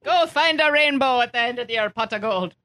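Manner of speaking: playful put-on Irish lilt; stage-Irish teasing; mock Irish accent. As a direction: do a joke leprechaun Irish accent.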